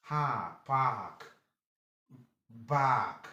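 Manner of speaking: "park" and "back" with a held "ah"; long A. Shown as a demonstration. Teacher shows A R gives long A, drawn-out "park". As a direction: stretch the vowel so "back" sounds like "baahk". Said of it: "gives you long ha".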